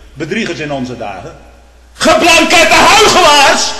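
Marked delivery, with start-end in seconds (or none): 1.9-3.7 huge slow pulpit shout, much higher; denouncing hypocrites at full volume; thundering denunciation. Retold or imitated. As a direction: roar it from the pulpit like Rutherford. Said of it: "zegt Rutherford vanaf de preekstoel".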